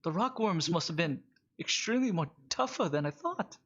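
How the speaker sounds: high, put-on character voice; Alistair. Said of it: "He just goes".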